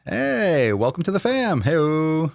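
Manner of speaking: high, cheery greeting; excited welcome. Real, not acted. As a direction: welcome them in brightly. Welcoming a new member.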